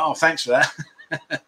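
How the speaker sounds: slow, laughing mock thanks; sarcastic thanks. Slow sarcastic thanks to a rude viewer comment. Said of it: "I was like".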